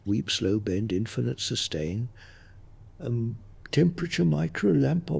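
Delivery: ordinary delivery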